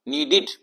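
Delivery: नीडेड pronounced NEE-did; short-i ending demo. Shown as a demonstration. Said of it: "ई की आवाज आनी चाहिए". (नीडेड के अंत में छोटी इ, "डिड")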